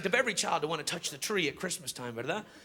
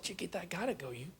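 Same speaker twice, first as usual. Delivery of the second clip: tender, hushed; 8 dB quieter than usual. Retold or imitated. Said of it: "I said".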